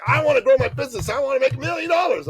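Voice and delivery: put-on high, fast, eager young guy; Dan's eager voice. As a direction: imitate the excited client blurting his dream. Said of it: "he says, like".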